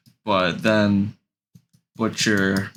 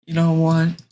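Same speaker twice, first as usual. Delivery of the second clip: high, louder put-on kid voice; hammed-up higher voice for a boy; son's voice; 8 semitones higher, 4 dB louder than usual. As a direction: play the son, pitched up. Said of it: "He's like".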